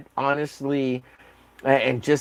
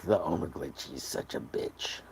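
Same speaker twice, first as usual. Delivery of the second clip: whispered jab; 9 semitones lower, 14 dB quieter than usual. Pretend.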